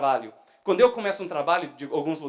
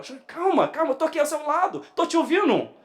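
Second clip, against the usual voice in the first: high, raised imitation of a fed-up plea; exasperated pleading; 11 semitones higher, 5 dB louder than usual. Erika telling Júnior she is right beside him, exasperated imitation. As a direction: beg a loud talker to calm down.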